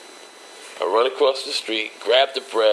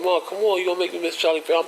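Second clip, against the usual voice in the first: put-on higher, fast whiny kid voice; child pleading; 9 semitones higher, faster than usual. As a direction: whine like a kid protesting. His child self pleading.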